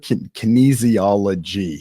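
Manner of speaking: "kinesiology" said slowly, syllable by syllable, after a false start; slow careful pronunciation. Shown as a demonstration. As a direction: carefully sound out "kinesiology" with a stumble first. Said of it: "Next time I gotta say it a little faster".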